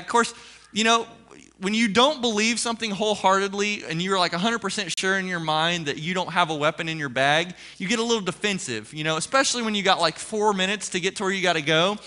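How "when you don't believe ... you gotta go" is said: normal talking voice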